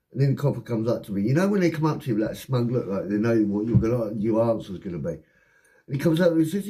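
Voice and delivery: ordinary delivery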